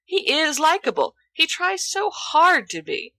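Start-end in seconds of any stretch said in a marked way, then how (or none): none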